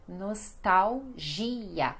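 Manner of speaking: "Nostalgia" very slowly, nos-tau-ZHI-a; Portuguese pronunciation. Shown as a demonstration. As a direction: break up "Nostalgia", long stressed GI. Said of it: "esse G é o mais forte".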